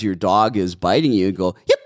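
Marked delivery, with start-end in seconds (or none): 1.7-1.8 sharp, high puppy yelp; high-pitched yip. Shown as a demonstration. Trainer shows owners the yelp, a high yip. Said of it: "That high-pitched yip".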